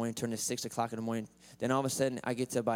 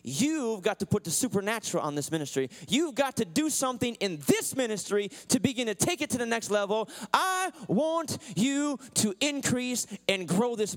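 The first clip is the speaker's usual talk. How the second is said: rising, louder, pushing harder each line; fervent pleading prayer; 8 semitones higher, 3 dB louder than usual. Retold or imitated. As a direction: beg God to grow the ministry, building up. Pleading prayer retold to God.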